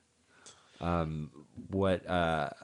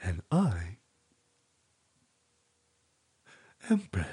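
Brad impression; slower than usual. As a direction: imitate your cohost Brad. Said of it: "pretty good Brad impression".

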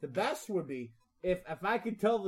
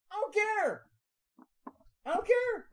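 mock apologetic squeak; 13 semitones higher, 5 dB louder than usual. Host retelling his reply to nagging, in mock high squeak. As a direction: squeak it out like a comic little protest. Acts out own dismissive reply.

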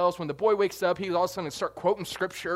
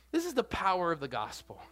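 ordinary talk

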